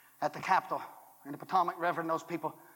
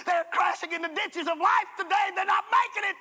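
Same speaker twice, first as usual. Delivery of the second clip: high and loud, shouting the call; full-force preaching; 10 semitones higher, 4 dB louder than usual. Acted. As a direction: preach it to the back row.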